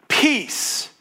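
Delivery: a lilting, comforting repeat; tender reassurance. Acted. The Lord calms Gideon.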